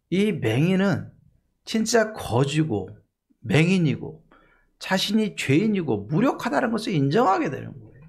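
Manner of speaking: ordinary talk, nothing marked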